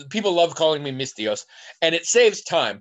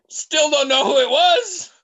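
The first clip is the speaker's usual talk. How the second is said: laughing; 7 dB louder than usual. Real, not acted. Laughing at the absurd encounter.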